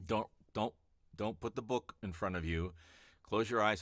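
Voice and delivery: ordinary delivery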